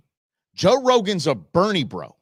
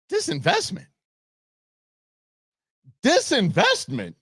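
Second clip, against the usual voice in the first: mocking falsetto. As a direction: sneer at the word in a squeaky voice. Mocking the mayor's word.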